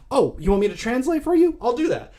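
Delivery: high-pitched put-on creep voice; mock helpfulness. Voices creep offering to translate.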